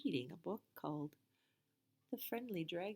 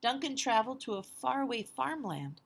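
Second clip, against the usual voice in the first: lively read-aloud voice, a bit louder; picture book read to children; storybook narration; 4 semitones higher, 6 dB louder than usual. A narrator's reading. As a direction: tell it to the kids with big energy.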